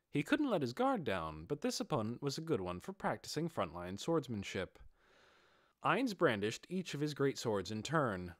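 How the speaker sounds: usual speaking voice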